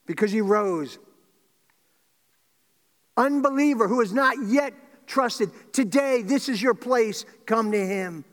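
loud, high-pitched appeal to the congregation; altar-call preaching. Real, not acted. Preacher calling straying Christians and unbelievers to come, impassioned appeal. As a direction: call them back with full force.

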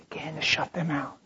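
soft whispered urging. Acted. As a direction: hush it to the room. Hushed instruction to the congregation.

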